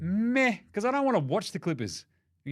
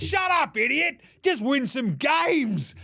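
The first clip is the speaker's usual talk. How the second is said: mock angry snap; 7 semitones higher, slower than usual.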